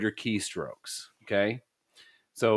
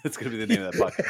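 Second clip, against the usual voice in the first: laughing; much faster than usual. Real, not acted. Cracking up at a joke.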